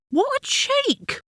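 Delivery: high, drawn-out mild outrage; indignant. A narrator's reading. Wife thinking about stranger's nosy questions, mildly indignant.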